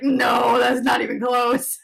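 loud, drawn-out mock protest; imagined scoffing reply. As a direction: exclaim it like an offended local. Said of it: "you probably are like".

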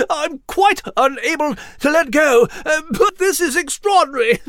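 amazed and giddy; floating mouse, thrilled; excited mouse. Acted. Nigel floating, laughing.

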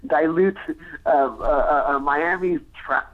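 usual speaking voice

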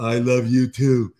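loud, slow, drawn-out mock reply; exaggerated kids' reply. Parent imitating kids answering at drop-off, exaggerated. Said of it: "not, like, rushed, like, really".

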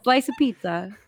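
New York accent. Shown as a demonstration. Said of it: "give it a shot".

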